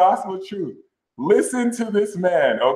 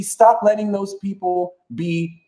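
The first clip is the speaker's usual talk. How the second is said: ordinary talk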